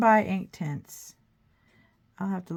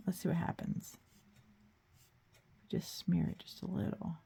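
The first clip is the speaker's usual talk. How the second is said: whispering; 8 dB quieter than usual. Real, not acted. Whispers while smearing paint.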